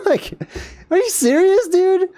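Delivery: can't believe what he's hearing; incredulous. Real, not acted. Scoffing at the cave guide's rule, high and incredulous.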